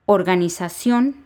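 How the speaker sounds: "Organización" stressed on CIÓN, rising; stress on last syllable. Shown as a demonstration. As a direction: lean on the final ó of "Organización". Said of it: "se acentúa la O".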